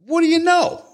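very high, loud mocking taunt. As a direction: jab the question high and hard. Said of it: "repeated taunt".

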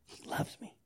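breathless whisper to himself; whispered prayer. Real, not acted.

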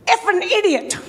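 mock insult from a rude caller; suddenly horrible. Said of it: "went from really Mister Nice Guy to Mister Horrible Guy".

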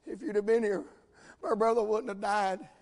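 soft, subdued imitation of weeping. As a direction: say it as Mary, mildly tearful at his feet. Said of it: "and starts weeping and starts crying".

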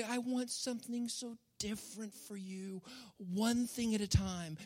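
whisper-close loving invitation; tender Jesus. Retold or imitated. Jesus inviting the congregation, soft and tender. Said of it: "look at us and say".

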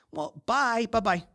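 high, dismissive bye-bye; curt mock goodbye. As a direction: brush someone off in play. Mock hanging up on someone.

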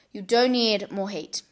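ordinary talk, nothing marked

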